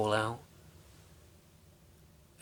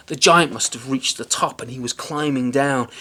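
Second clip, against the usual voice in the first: ordinary delivery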